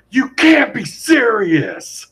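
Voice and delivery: high, drawn-out outraged yell; mock yell. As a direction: imitate an outraged moviegoer crying out. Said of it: "hear one guy just yell".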